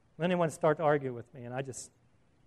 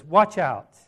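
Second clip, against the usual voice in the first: WATCH OUT punched much louder; emphatic command; 9 dB louder than usual. Acted. Preacher voicing Jesus's command, WATCH OUT said with loud emphasis. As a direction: give WATCH OUT as a forceful warning. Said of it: "It's a command with emphasis".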